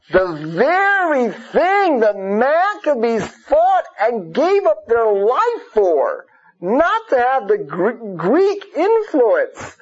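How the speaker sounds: drawn out, weighty and indignant; slow down and lean on every word; slow indignant emphasis. Real, not acted. Indignant point about the Maccabees.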